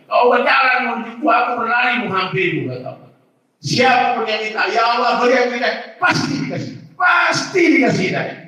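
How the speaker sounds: high, loud, fervent preaching; impassioned preaching. Real, not acted. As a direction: urge the crowd with rising passion.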